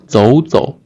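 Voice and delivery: 走走 rising first, light second syllable; second then gentle tone. Shown as a demonstration. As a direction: lift the first 走, let the second 走 drop lightly. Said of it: "from the third tone to the second tone".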